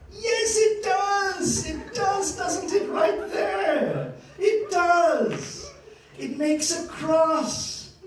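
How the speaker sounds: high, drawn-out jubilant cry with laughter; loud, high burst of delight; overjoyed. Real, not acted. Lecturer delighted the diagram makes a cross, overjoyed. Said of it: "I'm so happy".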